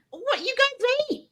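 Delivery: put-on Iranian-accented wrestler complaining at breakfast; Iron Sheik accent. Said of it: "with his little accent".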